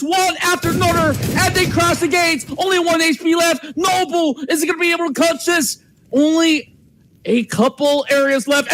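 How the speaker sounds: building live play-by-play; esports caster. Acted. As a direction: call the clutch as it happens.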